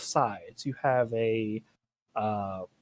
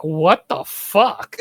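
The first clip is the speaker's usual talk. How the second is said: slow, drawn-out shocked question; louder disbelieving drawl; stunned disbelief; 2 semitones higher, 8 dB louder, much slower than usual. Retold or imitated. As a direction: let it sink in, baffled. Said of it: "it's just like".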